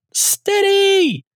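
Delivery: high, drawn-out fan scream, not full volume; retold scream. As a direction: reenact screaming out to a celebrity across a car park. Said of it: "I remember just screaming out".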